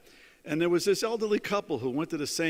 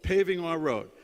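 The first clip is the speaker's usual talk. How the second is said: retold yell; 5 semitones higher, 3 dB louder than usual. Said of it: "they would yell at him".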